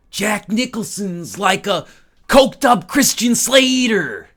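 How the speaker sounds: high, loud, stretched-out actor impression; Jack Nicholson. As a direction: drag the words out loudly like a hammy star. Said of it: "How about Jack Nicholson?".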